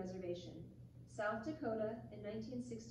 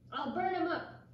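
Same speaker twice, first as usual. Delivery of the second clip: louder, higher angry threat; angry hunter; 6 semitones higher, 6 dB louder than usual. Acted. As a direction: snap it out in anger. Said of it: "answered one angry hunter".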